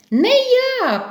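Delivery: very high little mouse squeak. Acted. Vert refuses to knead too, squeaking. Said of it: "пищить верть".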